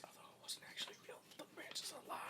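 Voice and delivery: hushed quick whisper; whispered side comment; whispered aside. Real, not acted. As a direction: slip it in under your breath as a secret aside.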